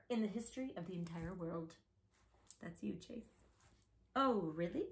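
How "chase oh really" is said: plain reading voice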